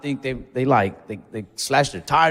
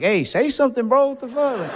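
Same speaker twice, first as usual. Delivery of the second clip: mock urging; 9 semitones higher than usual. Imagined prodding of cousin's boyfriend.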